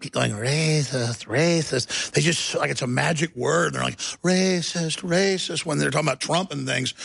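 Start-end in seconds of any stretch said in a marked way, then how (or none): 0.3-2.1 drawn-out sheep-like bleat; braying sheep. Retold or imitated. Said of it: "braying sheep". 4.2-5.7 lightly bleated mock chant; a hint of sheep in the voice; braying sheep. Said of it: "they're just braying like sheep".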